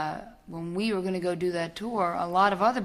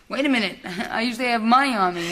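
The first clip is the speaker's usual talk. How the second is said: laughing through a startled little outburst, higher and louder; mock surprise; 5 semitones higher, 6 dB louder, faster than usual. To the interviewer, imitating herself finding no cash, laughing surprise. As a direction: act caught out with empty pockets, half-laughing. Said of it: "And it's like".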